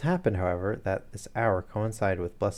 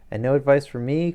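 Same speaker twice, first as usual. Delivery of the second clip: higher, louder plea, voice cracking; breaking voice; 6 semitones higher, 9 dB louder than usual. A narrator's reading. Frightened patient begging Holmes as he leaves, breaking voice. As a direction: cry out desperately, voice close to breaking. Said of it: "cried Blessington in a breaking voice".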